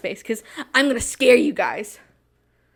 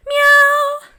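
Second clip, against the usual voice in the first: high playful meowing; cat meows; 10 dB louder than usual. Pretend. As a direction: meow like a cat. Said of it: "meows at the end".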